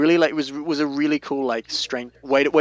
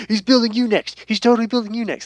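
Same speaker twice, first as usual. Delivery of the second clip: excited friend; 11 semitones higher, 5 dB louder than usual. Retold or imitated. Friend excitedly calling about the build.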